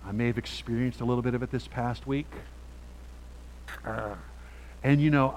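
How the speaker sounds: ordinary delivery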